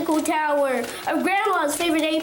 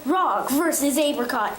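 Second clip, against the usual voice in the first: ordinary delivery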